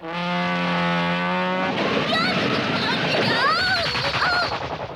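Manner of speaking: drawn-out tearful howl; long sobbing wail. Acted. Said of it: "stop crying".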